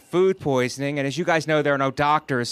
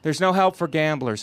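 normal talking voice

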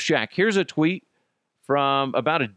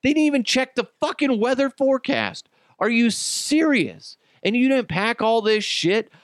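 much higher, disbelieving outburst; Are you serious, pitched way up in disbelief; exasperated rant; 10 semitones higher than usual. Real, not acted. Ranting at careless hikers.

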